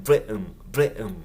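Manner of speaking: ブレトン with the T swallowed in a glottal stop; ブレトン said the casual British way with no clear T; dropped T. Shown as a demonstration. Teacher showing the Cockney-ish dropped T. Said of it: "Tがちょっと抜け落ちる".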